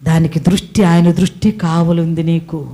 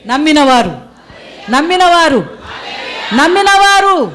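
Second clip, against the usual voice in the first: building to a shout; 9 semitones higher than usual. Real, not acted. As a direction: build each repeat to a joyful shout.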